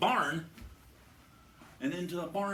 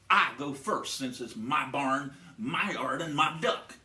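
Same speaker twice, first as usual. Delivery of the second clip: loud farmer; 4 semitones lower, 6 dB louder than usual. Acted. Farmer claims first kick.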